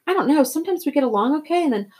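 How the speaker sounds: high-pitched, distressed outpouring; hurt, agitated confession; upset. A narrator's reading. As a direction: explain it while still shaken from crying. Upset boy explains the slap.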